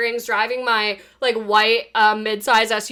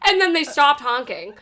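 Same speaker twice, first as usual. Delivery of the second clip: normal talking voice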